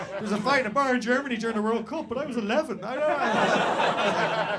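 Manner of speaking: high, loud mock bewilderment; panicked confusion. Stag racks his memory in the police car, confused wail. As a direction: imitate a flustered confession, wailing at the end. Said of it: "that level of fucking confusion".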